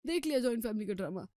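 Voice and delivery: fast, fed-up grumble; husband griping to his wife, fast and fed up; annoyed complaint. Acted. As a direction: rattle it off in irritation. Rahul annoyed at family.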